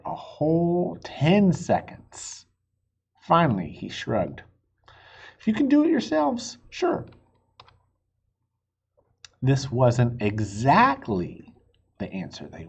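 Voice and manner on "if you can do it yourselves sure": landlord voice. Acted. Landlord shrugs reply.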